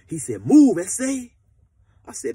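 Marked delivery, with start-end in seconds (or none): 0.5-1.3 loud, high barked order; hammed-up threatening shout; mock tough command. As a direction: bark it like a gangster. Prison yard confrontation retold.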